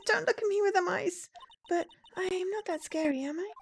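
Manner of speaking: put-on innocently puzzled young girl; sweet, earnest girl character. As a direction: play a naive little helper.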